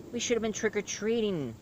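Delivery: high, whiny put-on voice; whining kid. Kid outside missing trick-or-treating, high whining voice. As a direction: complain in a high mock whine. Voicing the kids waiting outside.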